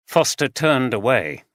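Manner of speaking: ordinary delivery, nothing marked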